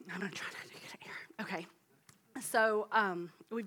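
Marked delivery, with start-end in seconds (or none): 0.1-1.7 breathy whisper, barely voiced; whispered aside. Real, not acted. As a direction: whisper it as you fight back tears. Aside while holding back tears.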